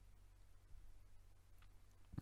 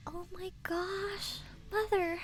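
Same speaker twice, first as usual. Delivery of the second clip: high cooing; 8 semitones higher, 6 dB quieter, slower than usual. Real, not acted. Player cooing at Mother Mushroom.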